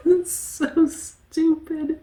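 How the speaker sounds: high, slow, squeezed through laughter; real giggling fit; helpless laughter. Real, not acted. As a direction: barely get it out for laughing. Cracks up at own joke.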